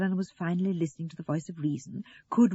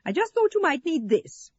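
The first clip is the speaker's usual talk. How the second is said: high, louder righteous offer; 10 semitones higher, 5 dB louder than usual. A narrator's reading. Eleanor handing over the corkscrew, righteous. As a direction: justify yourself, pitched high and pointed. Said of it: "Eleanor interrupted righteously".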